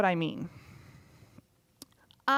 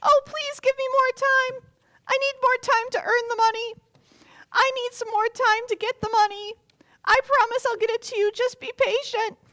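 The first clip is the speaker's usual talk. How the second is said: much higher, loud pleading voice; begging servant; 14 semitones higher, 6 dB louder than usual. Acted. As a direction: beg in a squeaky put-on voice. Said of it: "doesn't that sound like what he said to the king".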